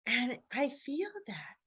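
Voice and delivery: soft hushed whisper. Real, not acted. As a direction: breathe it out in a whisper. Emotional moment, speaking under breath.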